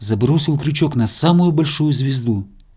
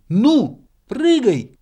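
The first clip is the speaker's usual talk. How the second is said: high, loud urging; squirrel shouting; 11 semitones higher, 5 dB louder, much slower than usual. A narrator's reading. Squirrel urging frightened hare to let go, high loud shout. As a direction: yell down to jump now. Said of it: "крикнула белка".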